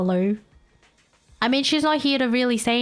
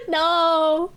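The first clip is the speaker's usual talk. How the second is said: usual speaking voice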